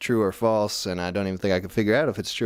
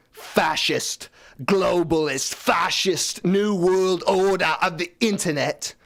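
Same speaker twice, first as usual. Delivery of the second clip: mock conspiracy rant; 7 semitones higher, slower than usual. Ranting about internet conspiracy.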